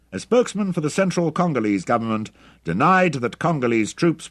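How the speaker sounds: high, loud newsreader delivery; loud radio announcer. A narrator's reading. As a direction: project it like an announcer reading a bulletin. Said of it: "announcer's voice came through loudly".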